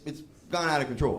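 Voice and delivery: ordinary talk, nothing marked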